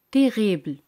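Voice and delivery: Terrible with a French uvular r and a falling close; French pronunciation demo. Shown as a demonstration. Teacher repeats Terrible in French for her students, crisp. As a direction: say Terrible crisply in French, the final e left silent. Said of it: "La palabra terrible en francés".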